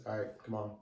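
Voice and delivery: low, very soft imitation of a calm man. Inspector waving recruits off the bus, imitated in a calm low voice. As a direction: say it quietly and unhurried, no yelling. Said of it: "he was so calm voiced".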